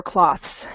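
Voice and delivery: "cloths" with an open aw vowel and hissed th-s ending; short O. Shown as a demonstration. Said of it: "without that E at the end".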